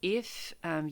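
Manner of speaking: usual speaking voice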